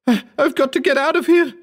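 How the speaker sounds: very high, desperate cry; frantic high-pitched shriek; panicked scream. A narrator's reading. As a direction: scream to escape. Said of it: "he screamed".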